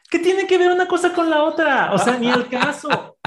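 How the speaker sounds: high, loud laugh through the words; laughing disbelief. Real, not acted. Mocking a partner's absurd threat, laughing loudly.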